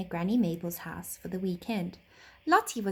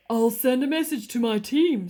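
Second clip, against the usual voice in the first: train driver voice; 3 semitones higher, 8 dB louder than usual. Acted.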